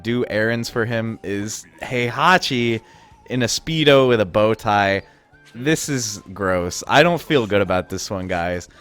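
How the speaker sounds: normal talking voice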